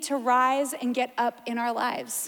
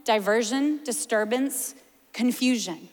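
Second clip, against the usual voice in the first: ordinary talk, nothing marked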